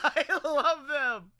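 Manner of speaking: slow, high laughing delight. Real, not acted. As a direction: laugh through the words.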